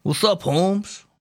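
slow, high gangster Italian greeting; a bit of gangster Italian swagger; mock gangster Italian accent. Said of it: "that went gangster Italian a little bit".

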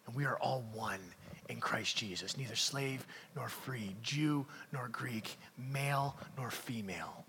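low, soft, solemn pulpit delivery; hushed preaching. Real, not acted. Preacher telling congregation they are God's children, hushed preaching. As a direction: let each phrase settle quietly on the listeners.

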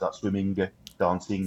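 "swimming, dancing" with the final G's sounded. Shown as a demonstration. Explaining the Brummie trope, sounded G's in "swimming, dancing". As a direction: hit the G ending "swimming" and "dancing". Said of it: "you really pronounce the G's at the end of words".

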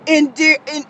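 ordinary talk, nothing marked

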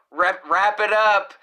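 slow, high, loud call to finish; interrupting producer. Retold or imitated. Imitating producer hurrying the band, loud call-out. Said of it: "it's like the producer came in".

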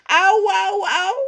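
high, loud ouch cries; moaning in pain. Acted. Raf stubbed his toe and moans high and loud. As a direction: whimper from the hurt toe. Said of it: "kermt hij".

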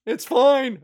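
high, loud startled yell. Retold or imitated. Retelling the raccoon moment, yelling it again. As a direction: shout it in panic. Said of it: "I yelled".

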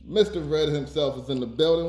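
ordinary talk, nothing marked

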